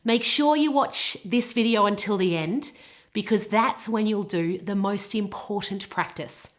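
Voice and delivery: ordinary delivery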